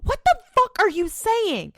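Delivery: high, incredulous exclamation; baffled outburst. Acted. Viewer reacting to a baffling line, incredulous. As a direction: blurt it in exaggerated disbelief.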